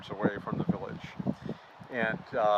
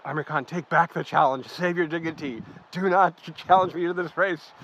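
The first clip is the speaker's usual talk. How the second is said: hammed-up loud higher taunt; mock bold challenge; 7 semitones higher, 7 dB louder than usual. Foreigner taunting the chief in front of the village, hammed-up loud. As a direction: boom it like a playful challenger.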